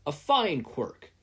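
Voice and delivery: slow, proud approving voice; a mild declaration from the stranger to Izuku; declaring. A narrator's reading. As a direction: pronounce it like a hero's verdict. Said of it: "Yagi declared".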